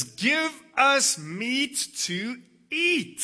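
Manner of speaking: loud, slow, high demanding cry. Retold or imitated. Hungry people crying out to Moses, loud and slow. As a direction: drag out the demand for meat. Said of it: "they're crying out to Moses".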